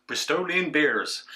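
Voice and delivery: slow, put-on Bristolian accent; a quick, terrible Bristolian accent; bad Bristolian accent. Shown as a demonstration. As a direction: drawl it in a mock Bristol accent. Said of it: "That was a terrible accent".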